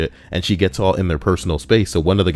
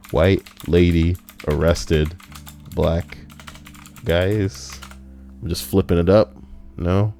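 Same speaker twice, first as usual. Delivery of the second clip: ordinary talk, nothing marked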